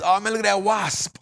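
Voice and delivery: WASP stretched, with a popped final P; exaggerated standard pronunciation. Shown as a demonstration. Host tries it in a sentence, WASP with overdone P. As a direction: lean on WASP and finish on a crisp "sp". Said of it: "say that in a sentence".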